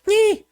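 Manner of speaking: very high, loud animal squeak; prairie dog squeak. Acted. Game master plays prairie dogs in the background, high squeak. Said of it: "all the prairie dogs in the background just go".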